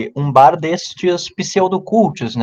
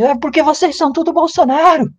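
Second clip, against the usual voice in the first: mimicking the girl's shout; 13 semitones higher than usual. Imitating the girl in the bar screaming at them, high mock yell. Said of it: "a menina gritou alto".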